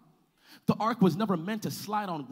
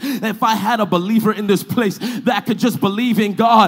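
full-force preaching; 16 dB louder than usual. Real, not acted. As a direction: build the refrain into a roaring declaration.

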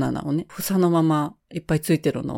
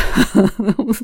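laugh; 4 semitones higher, 6 dB louder than usual. Real, not acted. Laughs at the mystery.

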